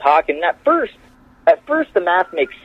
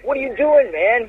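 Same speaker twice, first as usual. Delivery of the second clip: high-pitched mock complaint; upset producer; 9 semitones higher than usual. Producer upset at a cut, high mock exasperation. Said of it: "Vince would get upset".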